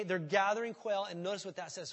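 usual speaking voice

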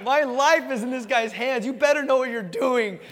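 high, loud mock-anxious warning; strapped-in skydiver warning his tandem partner; nervous warning. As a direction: lay your life in his hands, tense. Voicing his fear on the jump.

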